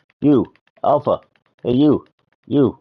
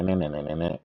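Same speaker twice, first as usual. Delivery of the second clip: usual speaking voice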